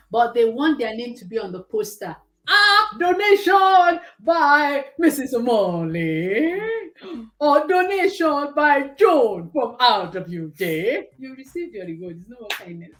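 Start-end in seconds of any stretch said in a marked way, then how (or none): 2.5-6.6 mock announcement. Mocking announced charity donors. 7.7-11.1 mock announcement. Mocking announced charity donors.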